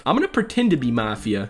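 ordinary talk, nothing marked

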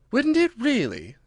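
high, drawn-out astonishment; great surprise. A narrator's reading. Alice hears about the porpoise, high and greatly surprised. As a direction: stretch the question high in surprise. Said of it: "in a tone of great surprise".

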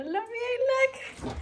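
high squeal of delight; real excited squeal. Real, not acted. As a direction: gush at the sweet note. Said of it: "touched by the note".

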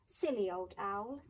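usual speaking voice

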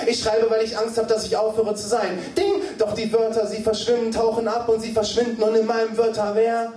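high, driving spoken word; insistent rhythmic recitation; urgent slam poetry. Acted. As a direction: hurtle through the lines breathlessly on the beat.

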